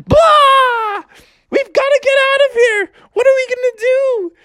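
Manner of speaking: high frightened shout; panic, no way out; high panicked cry. Acted.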